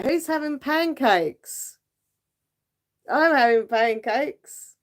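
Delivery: playful. Real, not acted. Playful pancake day sing-song.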